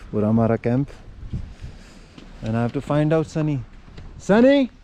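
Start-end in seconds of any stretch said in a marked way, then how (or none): 4.2-4.7 loud high call across the camp. Real, not acted. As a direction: shout his name to find him. Calling out for Sunny.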